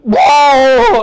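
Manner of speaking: a high, loud whoa like a huge beast braking hard; elephant pulling up. Retold or imitated. Imitating the charging elephant as it pulls up short, high and loud. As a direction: bellow the sudden stop. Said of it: "the elephant just went".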